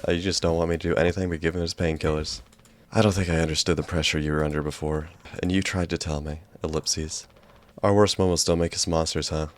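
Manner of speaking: ordinary delivery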